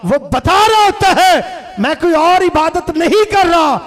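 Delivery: loud, high-pitched impassioned sermon delivery; full-force preaching. Acted. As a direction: hammer each phrase out to the crowd. Preacher acts out worshipper's silent answer. (पूरे जोश में ऊँची आवाज़ में तक़रीर)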